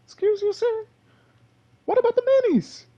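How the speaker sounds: a falsetto "excuse you" at a teammate; mock indignant. Gamer squeaking at his teammate over the minis, mock-offended. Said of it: "and I was like".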